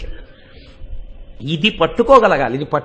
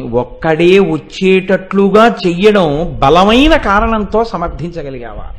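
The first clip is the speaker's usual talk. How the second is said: ordinary delivery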